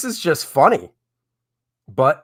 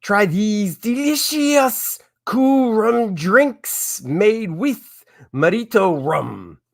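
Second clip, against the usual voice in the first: slow, drawn-out fake Mexican accent, higher at the start; bad Mexican accent; 7 semitones higher, much slower than usual. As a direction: badly fake a Mexican accent, stretching the words. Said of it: "read this next one in a Mexican accent".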